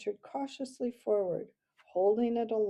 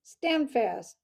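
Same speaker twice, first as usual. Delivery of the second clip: loud, higher-pitched military order; snapped command; 4 semitones higher, 8 dB louder than usual. A narrator's reading. Owl captain halts the mouse, snapping. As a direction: snap out a sudden halt order. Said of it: "snapped the captain".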